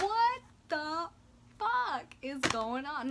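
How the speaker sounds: high, loud, drawn-out disbelief; retold outraged shock. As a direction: stretch each word in exasperated shock. Retold shock at popped tire.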